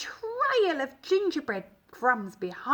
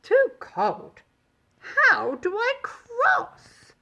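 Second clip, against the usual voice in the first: worried cookie; 10 semitones higher, 4 dB louder, slower than usual. Acted. Cookie stuck at the river.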